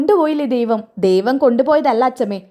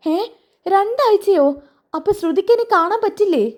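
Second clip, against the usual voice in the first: higher, startled disbelief; shocked grandmother; 8 semitones higher than usual. Acted. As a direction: gasp out the question in dismay. (ഞെട്ടലോടെ ചോദിക്കുന്നു)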